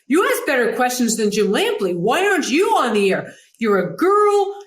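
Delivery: high, loud, exasperated impersonation; John McEnroe. Retold or imitated. McEnroe pushing his producer to go on air, exasperated. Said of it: "that tone of John saying".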